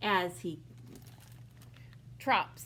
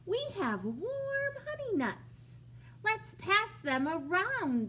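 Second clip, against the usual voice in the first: lightly voiced small critters; 9 semitones higher, 4 dB louder than usual. Gopher and Mole voiced.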